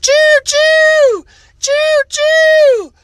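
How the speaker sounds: very high, loud choo choo like a toy train whistle; train whistle call. Acted. Kid playing train, shrill high whistle call.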